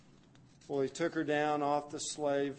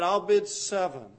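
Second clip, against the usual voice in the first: loud, higher bid call; auction bid; 6 semitones higher, 11 dB louder than usual. Acted. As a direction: outbid him, call it out. Bidder at slave auction.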